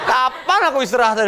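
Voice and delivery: mock-weary high whine with a laugh; tired shop owner complaining while packing; exasperated seller. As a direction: play the overworked packer, half laughing. Acting a tired online seller.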